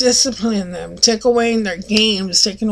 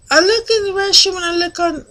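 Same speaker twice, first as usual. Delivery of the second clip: ordinary talk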